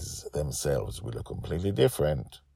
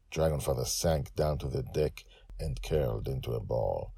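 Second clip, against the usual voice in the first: ordinary delivery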